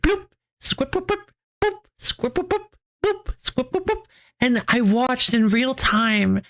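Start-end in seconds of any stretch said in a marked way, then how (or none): 0.6-4.0 high, soft squeaky boops; tiny squeaks for a spider spinning; spider web noises. Retold or imitated. Telling chat how the spider spun its web, high soft boops. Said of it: "and just went like".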